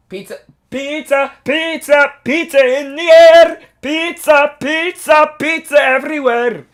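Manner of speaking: high, loud sing-song pizza chant; cartoon mascot chant; Pasquale impression. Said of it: "another impression of a Pasquale voice".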